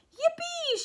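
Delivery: high whooping yippee; yippee shout. A narrator's reading. Grandpa Joe whooping in the rocketing elevator, high yippee. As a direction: whoop with joy as you shoot up. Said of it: "shouted Grandpa Joe".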